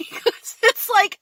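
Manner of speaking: slow words through a high laugh; laughing disbelief. Real, not acted. Laughing in disbelief at the rude shoppers behind her.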